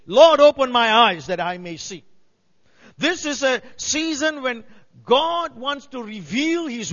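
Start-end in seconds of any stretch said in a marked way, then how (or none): 0.1-2.0 loud prayerful plea, falling away; pleading cry. Acted.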